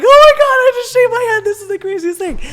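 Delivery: high, loud wailing freak-out; hammed-up tearful outburst; mock bawling. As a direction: wail it in overwhelmed disbelief, exaggerated. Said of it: "Just started bawling".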